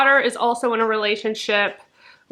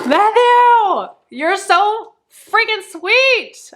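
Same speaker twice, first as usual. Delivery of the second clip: high, loud, tearful gush; overcome with emotion; 10 semitones higher, 5 dB louder, much slower than usual. Real, not acted. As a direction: cry out the thanks, overwhelmed. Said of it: "I'm literally getting emotional".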